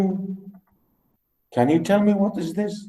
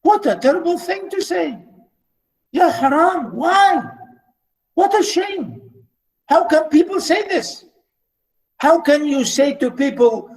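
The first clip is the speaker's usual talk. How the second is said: much higher, indignant mock horror; outraged disbelief; 9 semitones higher than usual. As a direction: cry out in shocked outrage at the insult.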